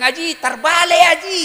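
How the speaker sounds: high, loud correction; loud urgent Haji terbalik; calling out. Retold or imitated. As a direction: shout to the old man it's upside down. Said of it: "saya bilang".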